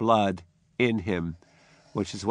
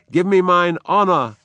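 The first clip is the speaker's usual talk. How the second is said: higher, louder order; commanding shout; 7 semitones higher, 7 dB louder than usual. Acted. Macbeth demanding his armor, commanding shout. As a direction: demand it like a king. Macbeth's line, then repeated plainly.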